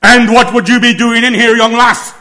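loud, high, gruff challenge; a loud, high stern voice; booming stern voice. Shown as a demonstration. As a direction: catch the intruder red-handed. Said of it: "varying pitch, volume".

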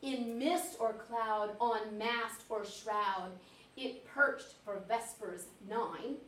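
slow, hushed verse reading; measured, atmospheric ballad telling; poem recitation. Acted. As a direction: recite softly, lingering on each phrase. Reads poem aloud.

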